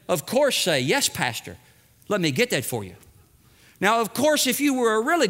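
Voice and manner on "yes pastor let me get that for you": lightly acted obliging neighbor, pitched low; low, soft, unhurried agreement; good neighbor. Neighbor agreeing to lend the pastor tools, obliging. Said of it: "you being a good neighbor".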